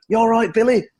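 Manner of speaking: a high, friendly star greeting, imitated. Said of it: "[name] was on".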